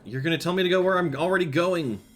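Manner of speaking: loud, high, exasperated complaint; exasperated yell. Real, not acted. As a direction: yell it at the screen. Yelling at the game's guidance.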